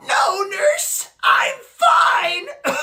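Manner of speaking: high, strained protest; sickly pleading. Acted. Sick boy begs nurse to let him stay, straining. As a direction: plead through a failing voice.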